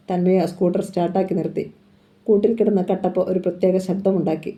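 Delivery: ordinary delivery, nothing marked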